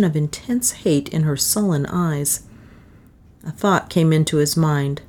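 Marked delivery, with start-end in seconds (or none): none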